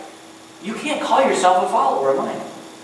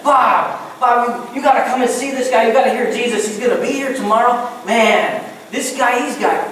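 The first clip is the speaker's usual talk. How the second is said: excited, higher-pitched sales pitch to a buddy; eager invitation; 5 semitones higher than usual. Acted. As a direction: talk your neighbor into coming along. Acting out eagerly inviting a neighbour.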